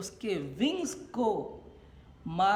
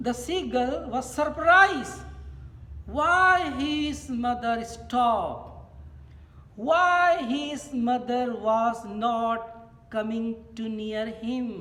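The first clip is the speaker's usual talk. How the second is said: louder, higher, slow read-out; declamatory reading aloud; 5 semitones higher, 6 dB louder, much slower than usual. A narrator's reading. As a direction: read it out slowly to a class, projecting. Reads lesson text aloud.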